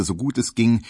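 ordinary delivery, nothing marked

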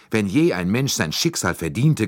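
mild, understated resentful rumble; grumbling anger. Acted. Young secretary telling the detective the man deserved it, mild grudging anger. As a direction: let a touch of grudge sit under the words. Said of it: "grollte er".

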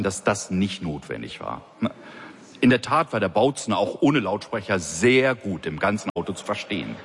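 ordinary delivery, nothing marked

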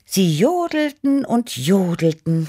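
high, drawn-out rapture; gushing. A narrator's reading. Cat storyteller recalling the yodelling contest, gushing. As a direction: gush over it, slow and high. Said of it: "schwärmte ich".

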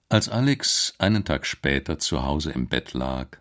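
ordinary delivery

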